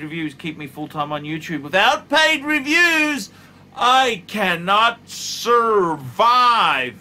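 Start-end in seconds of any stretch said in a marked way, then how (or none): 1.6-6.9 high, loud, slow dramatic plea; mock desperate wail. YouTuber begging viewers for paid reviews, mock wailing.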